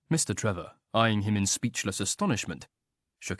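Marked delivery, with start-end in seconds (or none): none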